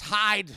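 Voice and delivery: "hide" punched out loud; stress on hide. Retold or imitated. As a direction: burst out on the word "hide". Boy declares his plan, retold.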